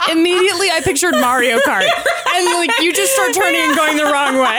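high, loud laughing talk. Real, not acted. Host laughing as she pictures Mario Kart. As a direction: talk through the giggles.

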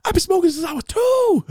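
high-pitched loud squawk; hammed-up squealing movie quote; Smokey from Friday. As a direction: shout it high, stretching the last word. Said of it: "and Smokey and Friday".

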